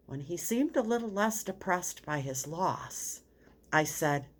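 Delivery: ordinary talk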